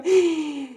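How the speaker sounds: sharp, high intake of breath; acted shocked gasp. Woman realizing the quake is no dream, gasping in shock. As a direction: gasp as the truth sinks in.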